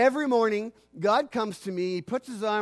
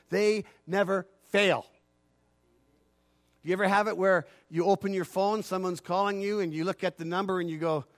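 normal talking voice

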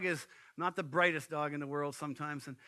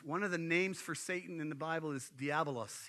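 normal talking voice

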